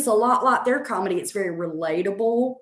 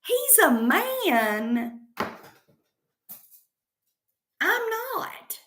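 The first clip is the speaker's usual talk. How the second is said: drawn-out, high-pitched mock disbelief; incredulous retort; 10 semitones higher, much slower than usual. Comedian answering those who compare her to Southern Mama, incredulous retort. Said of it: "I'm like".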